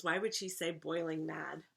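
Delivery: ordinary delivery, nothing marked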